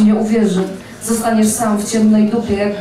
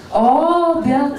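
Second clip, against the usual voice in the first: drawn-out, higher-pitched call to dinner, without real shouting; mealtime call; 4 semitones higher than usual. A narrator's reading. Parents calling children in from windows to dinner, drawn-out mock call. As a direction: imitate a long singsong call from a window. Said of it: "przerwały dopiero krzyki z kilku okien".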